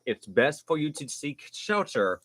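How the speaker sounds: normal talking voice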